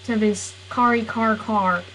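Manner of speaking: normal talking voice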